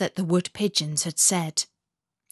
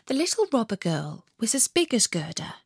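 ordinary delivery, nothing marked

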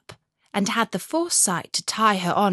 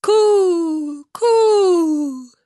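high, loud cooing of pigeons; 10 semitones higher, 9 dB louder than usual. A narrator's reading. Wood pigeons call to Gerda with high, loud coos. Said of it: "said the wood pigeons".

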